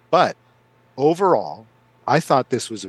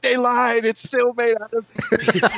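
mock dramatic sketch line; 9 semitones higher than usual. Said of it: "where it's like".

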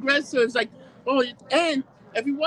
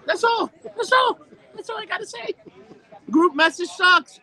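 higher, louder fed-up declaration; loud final rant; 9 semitones higher, 5 dB louder than usual. Real, not acted. Ending his group chat rant, loud and fed up. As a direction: slam the point home, shouting.

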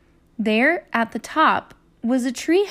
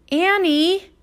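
high, calling shout; 7 semitones higher, 4 dB louder than usual. Acted. Jack shouts for Annie in the woods, a high call. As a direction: call her name into the woods. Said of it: "he shouted again".

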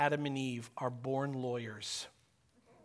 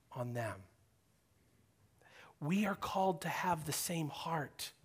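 whisper; 3 semitones lower, 8 dB quieter than usual. Real, not acted. Preacher urging the congregation, a hushed whisper. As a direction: lean in and whisper it to the room. Sermon application to the listeners.

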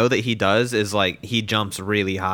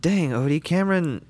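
higher passive aggressive mom voice; 6 semitones higher than usual. Retold or imitated. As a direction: needle him through the dog. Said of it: "your mom's like".

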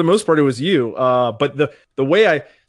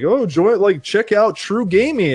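higher-pitched, lightly hyped promo; mock ad pitch; 8 semitones higher, 4 dB louder, slower than usual. Online ad luring a new streamer, lightly hyped mock pitch. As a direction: imitate an upbeat advert.

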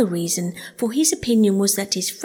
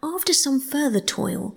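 plain reading voice

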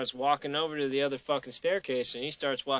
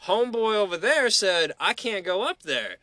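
mock indignant complaint; 7 semitones higher, 7 dB louder than usual. Annoyed guy retelling refusal.

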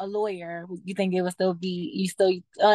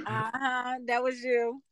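ordinary delivery

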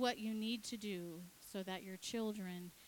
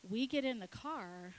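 usual speaking voice

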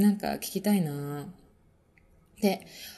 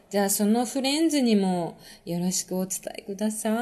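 ordinary talk

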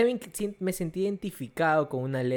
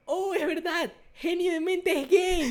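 higher, lightly voiced kid brother chiming in; little brother; 12 semitones higher, slower than usual. Retelling what his little brother said, light high kid voice. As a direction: play the little brother catching on. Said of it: "mi hermanito sí dijo".

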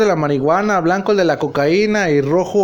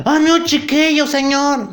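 high, swooning call to the pastor; mock fervent cry; 8 semitones higher, slower than usual. Worshippers call out to the pastor, high and swooning. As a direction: gush up high like a devoted follower.

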